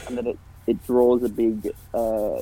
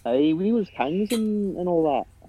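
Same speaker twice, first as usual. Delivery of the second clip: put-on Irish accent; 8 semitones higher, 3 dB quieter, slower than usual. Joins the Irish bit.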